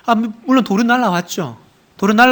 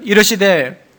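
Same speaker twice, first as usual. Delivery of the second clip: normal talking voice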